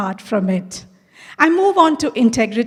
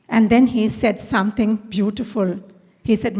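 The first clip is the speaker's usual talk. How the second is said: ordinary delivery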